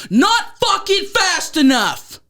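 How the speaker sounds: high, drawn-out angry yell; acting out a furious retort; re-enacted screaming. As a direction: scream it back, each word stretched. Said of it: "then I start screaming".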